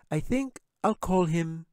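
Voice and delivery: put-on young girl voice. A narrator's reading. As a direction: play the little girl, scoffing. Narrator voices Katie.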